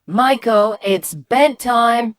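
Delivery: high, loud, drawn-out "bedtime". Retold or imitated. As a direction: call insistently to an ignoring child. Mum calls again, being ignored.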